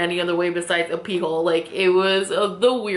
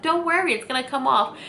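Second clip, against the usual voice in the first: nervous reassurance; 6 semitones higher than usual. Retold or imitated. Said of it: "I couldn't help but laugh because I was so nervous".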